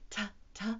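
"T, t" as short separate sounds; letter sound. Shown as a demonstration. Storyteller teaching children the letter T, sounded out. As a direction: sound out the first sound of tiger: "T, t".

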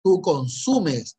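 drawn-out stern questioning; slow insistence. Shown as a demonstration. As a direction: spell out each word to the student. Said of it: "¿tú produces o consumes?".

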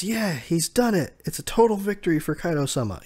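high mock cheering; celebrating. Reviewer voices Beast Pirates celebrating Kaido's win, mock jubilant.

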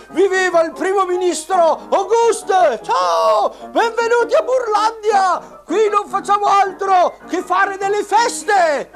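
very high shouting puppet; squeaky shrill puppet voice. Acted. Auguste the minister puppet.

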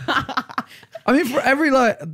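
laughing. Real, not acted.

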